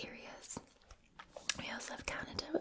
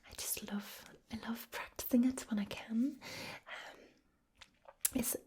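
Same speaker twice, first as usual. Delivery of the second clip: whispered Northern Irish lilt; soft Northern Irish ASMR whisper; soft Belfast accent. Shown as a demonstration. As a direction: whisper in a gentle, sing-song Belfast accent. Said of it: "I'm doing quite a soft, um, Belfast sort of accent".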